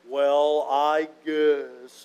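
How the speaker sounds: low, mopey donkey sigh of a voice; a lightly voiced Eeyore, sad and resigned; gloomy Eeyore. Preacher voicing a glum Eeyore for the congregation, low and mopey. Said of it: "Winnie the Pooh is like".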